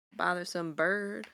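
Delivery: normal talking voice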